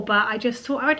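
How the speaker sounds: usual speaking voice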